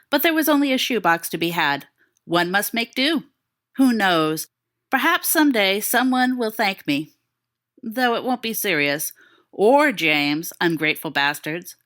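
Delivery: ordinary delivery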